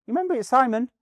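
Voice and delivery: high, loud reminder; high-pitched imitated "remember me?"; retold prompting. Retold greeting in the gym.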